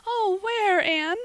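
high, loud tearful wail; sobbing. Acted. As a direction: cry it out in distress. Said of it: "sobbed Carrie Sloane".

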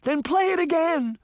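very high, pleading command; desperate high-pitched order; urgent cry. A narrator's reading. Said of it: "Sabrina cried".

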